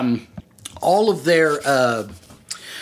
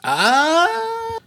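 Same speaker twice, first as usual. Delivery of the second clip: high held ah of realization; ah, remembering suddenly. Real, not acted. GM remembers NPC name.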